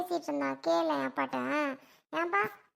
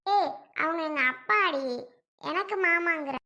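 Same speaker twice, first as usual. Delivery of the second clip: ordinary talk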